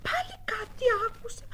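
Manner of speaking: whispered suspicion. Acted. Seeker hearing a noise, whispering. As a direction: breathe it, listening hard.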